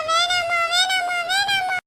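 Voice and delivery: high, slow whiny wail; mock crying. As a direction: wail it like a spoilt child. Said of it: "அதுக்கே இப்படி அழற?".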